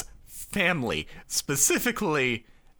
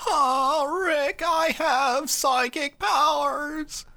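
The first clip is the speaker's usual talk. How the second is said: much higher, slow cartoon voice; Morty-like voice; 12 semitones higher, slower than usual. Friend tries Esperoba as a Morty sound-alike, high and slow. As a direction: try a Morty voice that slips into Shaggy. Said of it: "that's more of a Shaggy".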